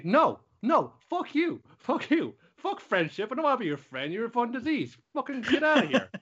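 hammed-up rage, spit out each fuck you; pissed-off magical girl. Magical girl turning on the villain, high furious rant. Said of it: "just getting pissed off and saying".

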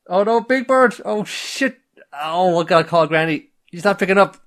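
high, loud hammed-up panic; frantic over the sick bird; mock panic. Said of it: "He's like".

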